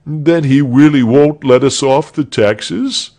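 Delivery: disappointed crowd. A narrator's reading. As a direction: ask it as a let-down crowd. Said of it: "asked the crowd in disappointed voices".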